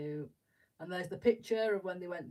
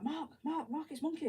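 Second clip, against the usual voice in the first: much higher urgent hiss of a name; fangirling; 9 semitones higher than usual. Retold or imitated. Wife telling husband Mark the star is here, high excited. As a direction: nudge him, bursting to tell. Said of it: "proper fangirling".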